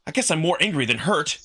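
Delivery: normal talking voice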